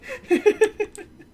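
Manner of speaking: loud, high burst of laughter; high giggle. Real, not acted. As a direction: cackle high at your own joke. Laughing at own joke.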